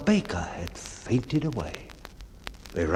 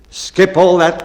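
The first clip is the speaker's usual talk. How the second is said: loud, hurried cry; hasty cry; 7 semitones higher, 9 dB louder than usual. Acted. Bellman hurrying the baker along, a loud hasty cry. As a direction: cut him off fast and loud. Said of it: "cried the bellman in haste".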